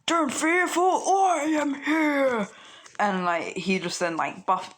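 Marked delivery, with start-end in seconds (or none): 0.1-2.5 high, slow heroic proclamation; All Might. Pretend. All Might arriving to reassure Izuku, high slow heroic voice. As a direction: boom out the hero's arrival, slow and lifted. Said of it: "All Might's like".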